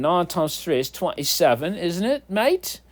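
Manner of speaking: mock British, lifting high at the end; jokey British voice with a high questioning finish; bad British accent. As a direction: put on a hammed-up British accent. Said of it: "Or is that British accent?".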